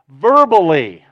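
verbally, shouted out loud and falling hard; loud emphasis. Acted. Preacher to the congregation, stressing the word verbally with a loud falling shout. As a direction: land the word verbally like a hammer blow.

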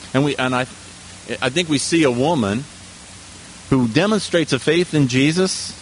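usual speaking voice

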